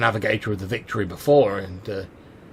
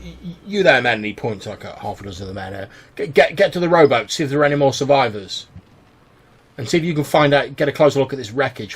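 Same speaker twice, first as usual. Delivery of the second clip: higher, loud commands to the crew; barked orders; 3 semitones higher, 5 dB louder than usual. Acted. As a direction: call the men to the rowboat. Cartwright barks orders to the men.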